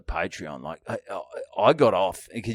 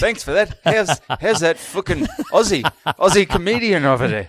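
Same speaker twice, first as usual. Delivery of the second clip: higher, louder hammed-up Aussie accent; mock Aussie voice, laughing through it; put-on accent; 8 semitones higher, 7 dB louder than usual. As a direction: pretend to be a stranger in a broad Aussie accent. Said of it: "And an accent".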